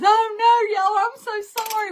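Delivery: high, loud retold apology; hammed-up embarrassed wail; mock apologetic mother. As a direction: imitate a mortified mom, laughing it up.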